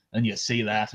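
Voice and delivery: usual speaking voice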